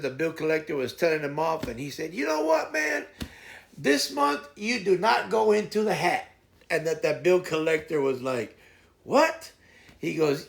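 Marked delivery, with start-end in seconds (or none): 2.1-6.3 retold telling-off. Friend yelling at bill collector. 9.0-9.5 shocked what. Retold or imitated. Bill collector's shocked reply.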